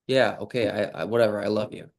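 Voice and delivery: brushed-off, careless "I love you" with a shrug in it; grudging, offhand "I love you"; dismissive i love you. Shown as a demonstration. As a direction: toss it off like it doesn't matter. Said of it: "you're trying to change the emotion".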